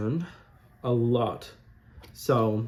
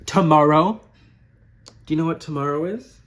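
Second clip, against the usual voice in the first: much louder, rising teaser; excited tease; 3 semitones higher, 8 dB louder than usual. Real, not acted. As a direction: hype it up like a big reveal. Teasing big announcement.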